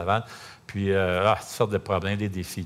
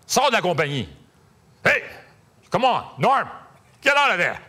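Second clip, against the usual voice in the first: higher and louder, urgent English shouts; Jeff's booming rebuke; 4 semitones higher, 3 dB louder than usual. Retold or imitated. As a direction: bark the orders at a friend to get out now. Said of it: "il y avait une voix de même".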